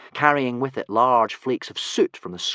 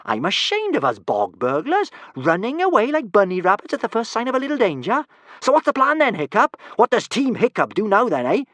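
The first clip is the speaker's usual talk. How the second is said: put-on high, loud, cheeky scolding girl; mock the runaways and rally Hiccup; much higher bold girl, scornful then eager; Kamikaze, scornful; 12 semitones higher, 9 dB louder than usual. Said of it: "said Kamikaze".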